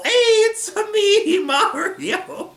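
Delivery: high, loud Italian cartoon voice; bouncy, drawn-out Italian catchphrase; Mario. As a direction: sing it out high with an Italian accent. Breaks into a Mario impression.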